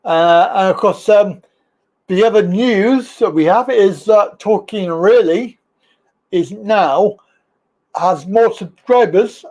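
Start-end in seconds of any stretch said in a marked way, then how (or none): none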